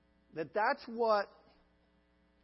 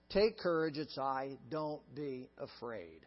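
gentle reassurance; 7 semitones lower, 3 dB quieter than usual. Retold or imitated. Jesus comforting, soft low.

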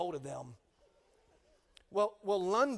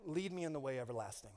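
quiet prayer; 6 semitones lower, 8 dB quieter than usual. Shown as a demonstration. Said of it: "Or I can say".

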